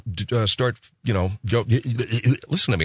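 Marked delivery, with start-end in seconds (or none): 1.5-2.8 stammering on the first sound; stuttering. Real, not acted. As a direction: get stuck repeating the y. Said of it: "I'm stuttering".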